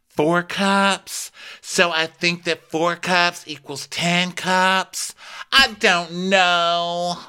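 high, slow and confused; mock her puzzling over coffee scoops; ditzy girl voice. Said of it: "and she's like".

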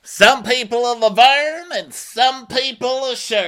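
high, loud caricature voice; put-on interjection. Erica helping Rinna name a duo, put-on loud voice. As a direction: blurt it high and emphatic. Acted interjection in retold scene.

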